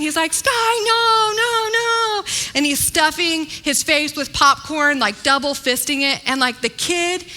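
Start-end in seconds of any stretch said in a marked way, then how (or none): 0.3-2.2 put-on toddler yelling at screen. Little boy warns a cartoon pup on screen, high mock yell. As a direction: squeal it up high like a two-year-old warning a cartoon. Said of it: "He's, like, yelling at the characters".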